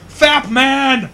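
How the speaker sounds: mock scream in the middle of a test. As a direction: blurt it out at the top of your lungs in a silent exam hall. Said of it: "you just scream out".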